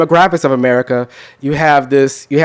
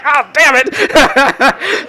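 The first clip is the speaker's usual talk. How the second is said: mock dismay; 12 semitones higher, much slower than usual. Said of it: "You're like".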